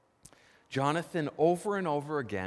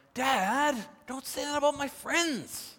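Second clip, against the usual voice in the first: pleading son; 12 semitones higher than usual. Retold or imitated.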